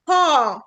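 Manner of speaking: car with the R dropped; car without any R; British car. Shown as a demonstration. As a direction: say car as a long open kaa with no R. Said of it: "عايزة تنطقي ال R".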